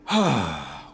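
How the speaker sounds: heavy exasperated sigh, dropping low; loud frustrated sigh. Acted. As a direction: let out a loud fed-up sigh. Frustrated waiting for train.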